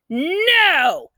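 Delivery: high sharp snap; snapping. A narrator's reading. Girl snapping at her brother about her sister. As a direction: bite off the name angrily. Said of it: "I snapped".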